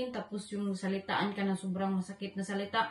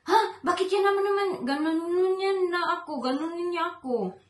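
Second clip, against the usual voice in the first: high, loud imitation of his outburst; wife mimics her husband's tantrum, mock angry; 7 semitones higher, 6 dB louder than usual. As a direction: throw his angry reaction back, a bit overdone. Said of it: "siya yung magagalit".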